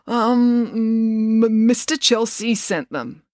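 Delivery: slow, hesitant stutter on "m-, Mister"; stammering. Acted. Joe hiding the truth from Finn, stammering. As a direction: stall with um then stumble. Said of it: "without stammering".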